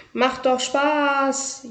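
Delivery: high, slow, drawn-out delight; gleeful squeal. A narrator's reading. Pinky loops beside the bus, drawn-out and high with glee. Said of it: "jauchzte Pinky".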